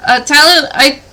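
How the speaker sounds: calling out from downstairs; Farron. Acted. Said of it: "you hear from downstairs Farron's voice say".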